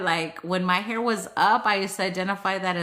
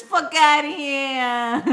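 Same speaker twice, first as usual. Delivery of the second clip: loud, drawn-out New Jersey brush-off, high-pitched; New Jersey accent; 5 semitones higher, 4 dB louder, slower than usual. Acted. Reading the card aloud to friends, in a drawn-out New Jersey accent. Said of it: "Say it in a New Jersey accent".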